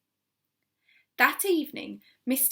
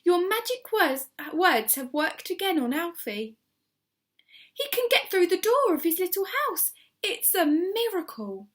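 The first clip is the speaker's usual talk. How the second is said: overjoyed; 8 semitones higher, 5 dB louder than usual. A narrator's reading. Overjoyed neighbour calling up.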